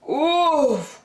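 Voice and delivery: high little grunt of effort; hammed-up oof. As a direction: strain as if lifting something heavy. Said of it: "grunted Douglas".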